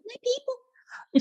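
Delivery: a happy, slow squeak; high squeaky delight. Retold or imitated. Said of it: "It's like".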